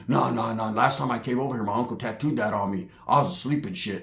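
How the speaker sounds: put-on low, hushed young dude; lightly voiced nervous nephew; a hint of a mumbling kid; nephew's voice. Nephew explains the tattoo.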